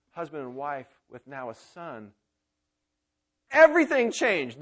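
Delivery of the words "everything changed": emphatic burst. Acted. Preacher's sudden emphatic outburst.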